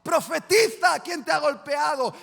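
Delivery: high, loud taunting shout; jeering mockery. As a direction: bellow the taunt as a cruel guard. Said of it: "se burlaban de él diciendo".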